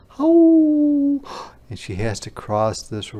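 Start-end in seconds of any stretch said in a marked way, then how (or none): 0.1-1.2 high, loud wolf howl; drawn-out high wolf call; wolves calling. Acted. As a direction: howl long and high to a distant pack. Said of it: "following the sound of".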